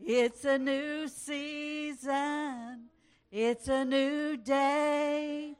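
ordinary talk

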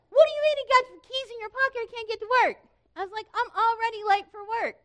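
high, loud, fast panicked shouting; frantic yell. Retold or imitated. Wife yelling at husband who pocketed her keys, frantic. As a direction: let the panic and anger explode.

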